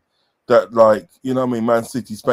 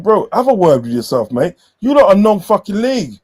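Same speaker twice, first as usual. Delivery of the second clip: higher-pitched, worked-up telling-off; indignant retort; 7 semitones higher, 3 dB louder than usual. Retold or imitated. Fan retelling his comeback to a non-league supporter, indignant. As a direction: put the rival fan firmly in his place.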